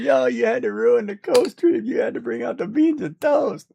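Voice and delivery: squeaky imitation of a friend; high mocking voice. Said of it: "She's like".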